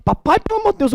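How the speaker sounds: put-on high urgent child voice; imitating daughter. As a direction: squeal it like the worried girl. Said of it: "ela já chega".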